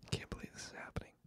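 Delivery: very faint, quick muttered whisper; mock-worried whisper. Podcaster reacting to the pretend hit on him, mock-scared whisper. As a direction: whisper to yourself in pretend disbelief.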